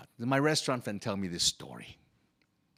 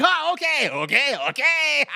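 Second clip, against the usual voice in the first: high, loud laughing mimicry of the diner; mocking cooks; 13 semitones higher, 9 dB louder than usual. Kitchen cooks mock the customer, laughing and loud. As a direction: cackle the okays at the customer. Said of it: "The cooks start laughing hysterically".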